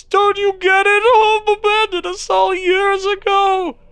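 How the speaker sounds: loud high howl, drawn out; slow loud anguished cry; despairing shout. Acted. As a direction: bellow it in despair. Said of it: "he shouts".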